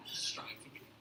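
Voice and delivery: put-on low, quiet Aussie accent; hushed beer slogan in an Australian accent. Comic quoting a beer slogan, Australian accent. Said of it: "with an accent".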